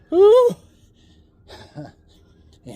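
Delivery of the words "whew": high loud whoosh of breath from the cold; gasping whew in icy water; cold shock exhale. Real, not acted.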